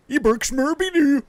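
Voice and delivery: very high and slow silly nonsense voice; gibberish doctor voice. Pretend. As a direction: squeak out nonsense as the goofy doctor.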